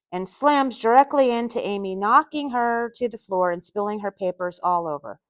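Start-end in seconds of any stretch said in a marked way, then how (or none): none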